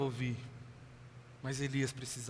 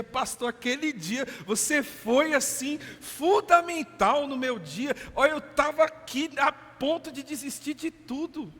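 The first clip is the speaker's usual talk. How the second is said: a higher, louder, slowed gushing churchgoer; effusive thanks; 10 semitones higher, 7 dB louder than usual. Retold or imitated. Churchgoer thanks the pastor, gushing and higher-pitched. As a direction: gush with exaggerated gratitude.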